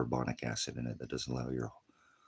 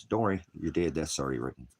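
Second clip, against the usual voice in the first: ordinary delivery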